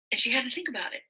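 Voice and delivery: ordinary delivery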